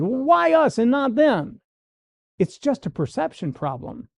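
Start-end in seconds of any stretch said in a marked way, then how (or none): 0.0-1.6 resentful whine. Retold or imitated. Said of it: "That attitude".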